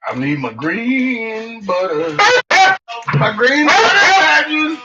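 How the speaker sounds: high, slow, pleading pet voice; sing-song voice for the begging dog; voicing the dog. Owner voices dog begging for butter, high slow whine. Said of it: "voicing the dog's wish".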